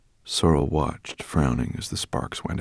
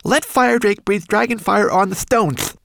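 high, loud, urgent creature voice; agitated cry; 8 dB louder than usual. A narrator's reading. Homunculus begs for dragon fire, in agitation. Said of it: "he cried in agitation".

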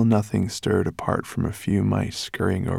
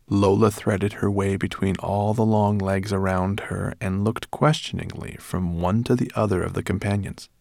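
ordinary delivery, nothing marked

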